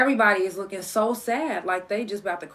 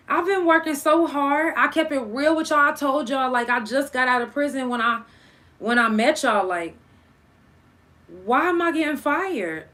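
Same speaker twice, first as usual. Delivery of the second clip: retold tearful plea; 6 semitones higher, 4 dB louder than usual. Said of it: "so I'm crying".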